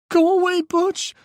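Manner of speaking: high, loud, panicked shout; frightened yell. Acted. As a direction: scream him away from the door. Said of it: "Micah yelled at the guy".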